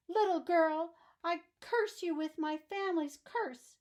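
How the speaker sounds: damn her with all your rage; furious curse. Acted. Archduke shouting a curse at Annabel, loud and high. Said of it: "hurled the box out ... and shouted".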